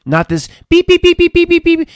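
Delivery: mocking phone users in a loud French accent. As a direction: rant in a put-on French accent, beeps high and mocking. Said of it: "He's imitating all the people down into their phones".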